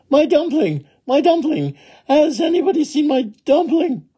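very high-pitched calling; crying out. Acted. Little woman running down the road, high crying out. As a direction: call out high and urgently to anyone.

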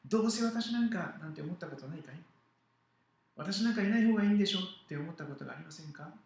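put-on animated children's story narration; lively Bible story read to children; storytelling to children. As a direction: tell it to the kids with big expressive swings.

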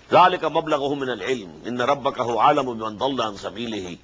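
melodic, measured tajweed chant; Quran recitation. Acted. Teacher recites the verse to his students, chanted tajweed. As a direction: intone the verse as in prayer.

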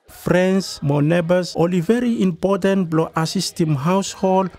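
usual speaking voice

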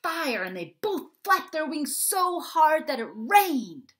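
put-on high, slow and eager little kid voice; breathless child narrating a battle; excited kid storyteller. A narrator's reading. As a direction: spin the tale big and wide-eyed. Child voice telling dragon tale.

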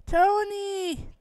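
high, drawn-out excited cry of a name; delighted call. Acted. Dee spots long-lost big brother Tony, excited cry. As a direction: call out joyfully, pitch shooting up.

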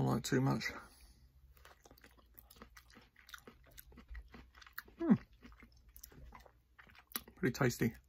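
talking while chewing; mouth partly full. Real, not acted. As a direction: after biting fruit. Seen 0: Mango bitten right before; lips closed, chewing, mango held near mouth.

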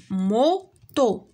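موتو said slowly as mo-to, two separate beats; syllables clapped out. Shown as a demonstration. Teacher to pupils, counting syllables: موتو split slowly into mo-to. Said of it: "غير زايدين".

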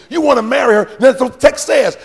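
ordinary talk, nothing marked

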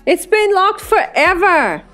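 high-pitched holler; shouted complaint. A narrator's reading. Narrator complains to Brianna about the locked bathroom, shouted. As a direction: call it out high over the party.

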